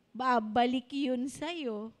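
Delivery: ordinary delivery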